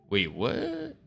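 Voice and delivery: ordinary talk, nothing marked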